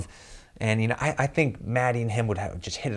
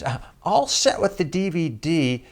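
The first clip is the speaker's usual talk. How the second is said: higher mock producer voice; imitating the producer; 9 semitones higher, 4 dB louder than usual. Producer turning down the DVD in a higher mock voice. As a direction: decline it as the bored producer. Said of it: "And she goes".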